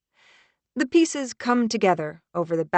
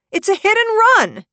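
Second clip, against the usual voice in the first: very high, loud, frantic shout; 10 dB louder than usual. Acted. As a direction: yell it in alarm. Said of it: "I shout".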